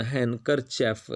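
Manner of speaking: हैंकरचेफ said without the d, as haing-kar-chef; हैंकरचेफ clearly spoken with the d dropped, the way a teacher shows it; handkerchief pronunciation. Shown as a demonstration. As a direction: say हैंकरचेफ with a silent d and no hand sound. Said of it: "ये हैंड नहीं पढ़ना".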